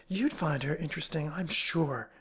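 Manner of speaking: plain reading voice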